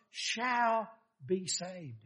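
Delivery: drawn out, very slow; slow, deliberate promise; slow emphasis. Real, not acted. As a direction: lean on each word slowly. Slow emphatic scripture ending.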